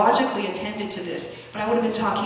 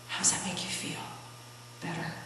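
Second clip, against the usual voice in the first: hushed; 3 semitones lower, 12 dB quieter than usual. Retold or imitated. Quiet talk with crying son.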